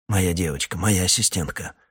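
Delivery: usual speaking voice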